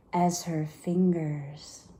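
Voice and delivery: slow unvoiced whisper; fading chilly whisper. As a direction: let the words go cold and slow. Describing her cold distant voice.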